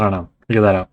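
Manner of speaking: throat irritated by dust, coughing. Real, not acted. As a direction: speak through a dusty cough. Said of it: "I'm constantly coughing".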